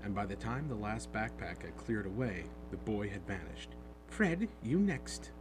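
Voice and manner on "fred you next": slow, higher call to her son; mother calling. A narrator's reading. Plump mother sending her son toward the barrier, slow call. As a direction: send the next boy off, a touch motherly. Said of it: "the plump woman said".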